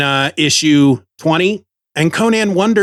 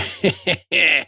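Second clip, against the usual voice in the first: chuckling Conan; 2 semitones higher, 4 dB quieter than usual. Retold or imitated. Host voicing Conan chuckling to himself. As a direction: chuckle like Conan at his own joke. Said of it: "Conan kinda going".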